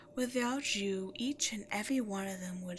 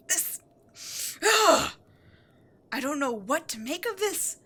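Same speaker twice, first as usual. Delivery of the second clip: higher, louder, exasperated ranting; furious rant; 4 semitones higher, 7 dB louder than usual. Acted. Demon queen raging at her defiant minion, furious. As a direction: let the fury boil over at the traitor.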